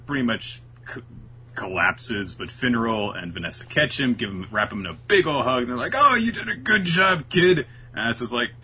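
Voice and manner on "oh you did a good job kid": put-on higher and a bit louder, cooing over the kid; playful imitation of proud comrades; mock fond praise. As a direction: pat him on the back with your voice. Voicing teammates praising Asta.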